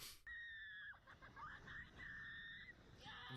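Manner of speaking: a real burst of high, shrieking laughter. Real, not acted. Reacting to the dad's studio, a high shrieking laugh.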